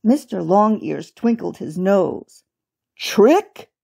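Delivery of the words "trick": high, incredulous yelp; crying out. A narrator's reading. Old rabbit accused of tricks, high indignant cry. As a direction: question it in protest, pitched up. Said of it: "he cried".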